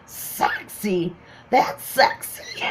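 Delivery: breathless, voiceless incredulous wheeze; imitated helpless laughing. Friend mocking her sexy shirt, helpless laughing. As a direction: mimic someone too tickled to get words out. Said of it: "He was laughing so hard".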